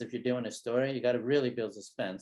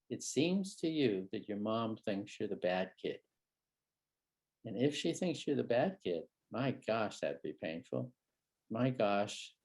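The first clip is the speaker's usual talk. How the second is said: softer, lower and slow; tender validation; 4 semitones lower, 6 dB quieter than usual. Shown as a demonstration. Therapist validating a hurting child, tender and soft. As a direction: gently reflect his pain. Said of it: "ready for a bit of vulnerability. So I say".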